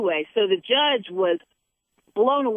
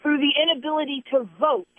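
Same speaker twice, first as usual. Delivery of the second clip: preaching; 6 semitones higher than usual. Real, not acted. Host preaching to a listener about reaping, high and intense.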